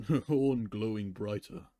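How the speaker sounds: usual speaking voice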